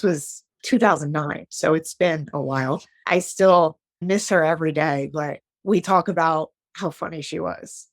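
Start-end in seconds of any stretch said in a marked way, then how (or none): none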